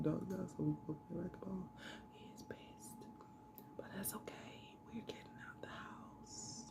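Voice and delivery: secret whisper with a stifled laugh. Real, not acted. Whispering so son won't hear.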